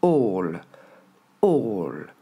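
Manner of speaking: ALL with a long "aw" sound; reference word ALL. Shown as a demonstration. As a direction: model English "all", falling, one at a time. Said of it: "votre père, ça va être le mot A deux L".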